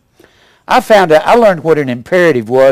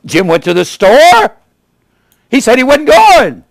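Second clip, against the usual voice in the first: raised, exclaiming voice with a surprised lift; exclamatory demo; 7 semitones higher, 4 dB louder than usual. Shown as a demonstration. Preacher showing the class an exclamatory sentence, high and excited. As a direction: sound shocked, like the sentence ends in an exclamation point. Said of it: "ends with an exclamation point".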